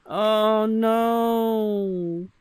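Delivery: high, loud sudden cry; surprised oh no. Real, not acted. When the game player misses out, loud high dismay.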